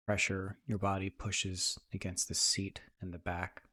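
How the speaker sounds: soft, even meditation voice with long pauses; guided meditation. Acted. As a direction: ease listeners into calm, leaving space between phrases.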